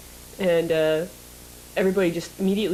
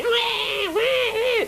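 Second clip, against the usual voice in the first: put-on baby wailing; much slower than usual. Imitating a crying baby to the plane. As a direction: mimic a baby crying, wah wah. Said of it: "it starts crying immediately".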